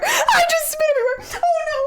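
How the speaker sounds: high laughing cry; laugh-shriek through it. Real, not acted. Laughing after spitting on monitor.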